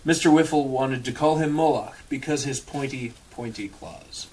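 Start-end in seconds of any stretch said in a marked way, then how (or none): none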